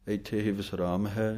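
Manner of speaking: ordinary talk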